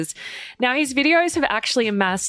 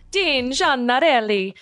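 higher, lilting Italian accent; 3 semitones higher than usual. Shown as a demonstration. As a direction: say the name again as an Italian would. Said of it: "say his name like that every single time".

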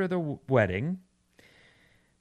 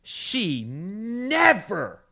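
"never" drawn way out with swooping pitch; stress on never; 5 semitones higher, much slower than usual. Retold or imitated. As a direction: stretch "never" slowly, high then falling, for drama. Dramatizing the wife's legal complaint.

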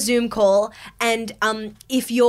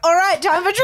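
high, brightly announced host line; mock trivia host; 7 semitones higher, 3 dB louder, faster than usual. Host jumping straight back in after a eulogy, mock chirpy announcement. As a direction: launch the quiz far too eagerly. Comic retelling abrupt switch to hosting.

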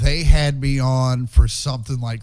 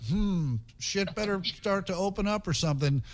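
much softer, murmured to himself; muttered worry; 5 semitones higher, 9 dB quieter than usual. Retold or imitated. Comic recalling lockdown money worries, soft mutter. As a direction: mutter it under your breath. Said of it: "about the time I was like".

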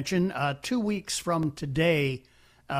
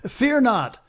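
chuckling "Fear not", pitch much higher, laugh running through both words; 8 semitones higher, 4 dB louder than usual. Real, not acted. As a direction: laugh it out, amused and reassuring. Host reassures caller, laughing.

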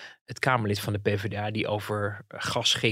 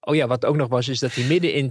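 normal talking voice

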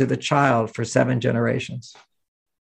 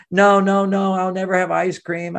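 mock despairing child; 5 semitones higher than usual. Daughter voiced by father.

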